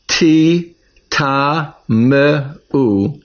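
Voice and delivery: "ti ta me u" split slowly into syllables. Shown as a demonstration. Teacher spells out the word for students, slow syllables. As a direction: sound out "ti ta me u" one syllable at a time. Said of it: "I said it was".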